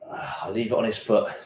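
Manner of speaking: softly whispered aside. Real, not acted. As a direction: whisper so the dog won't notice. Quiet aside near dog.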